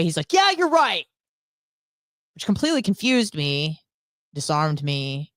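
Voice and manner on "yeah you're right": snapped-back agreement, pitched high; imitating the neighbor's hostile concession; mock hostile reply. Neighbor answering her yelling, hammed-up hostile. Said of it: "in a very hostile way".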